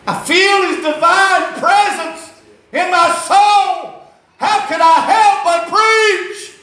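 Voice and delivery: high, loud, slow preacher's cry; preaching shout. Retold or imitated. Preacher in the car shouting his call to preach, slow loud cry. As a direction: cry it out like preaching at full force. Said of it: "And he said".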